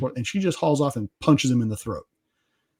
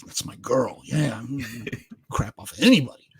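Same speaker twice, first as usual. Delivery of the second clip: proud dad; 3 dB quieter than usual. Retold or imitated. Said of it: "on the other hand, you're like".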